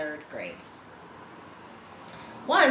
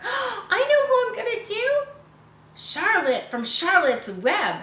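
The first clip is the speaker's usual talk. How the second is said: put-on excited girl; 12 semitones higher, 5 dB louder than usual. Girl voiced excitedly in story.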